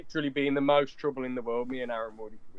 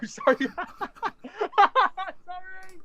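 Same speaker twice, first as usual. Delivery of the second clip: high laughing apology; real flustered giggle, much higher; embarrassed laugh; 7 dB louder than usual. Real, not acted. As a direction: laugh it out high, then squeak sorry. Embarrassed after naming town.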